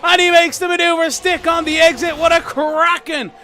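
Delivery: excited. Real, not acted. Overtake called live in race.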